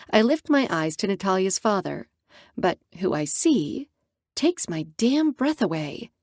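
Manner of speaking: ordinary delivery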